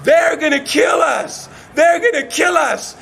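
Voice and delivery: storyteller's mock scream; screamed warning. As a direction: cry out like terrified mothers. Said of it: "both mothers screamed out".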